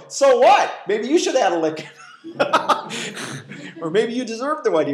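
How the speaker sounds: loud, high, laughing comeback; mock retort. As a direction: throw it back with a laugh. Retorting to lenient parent.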